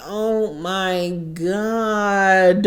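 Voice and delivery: slow, stunned, dragged-out disbelief; drawn-out shocked reaction. Retold or imitated. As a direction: let it crawl out in shock. Own stunned reaction to read.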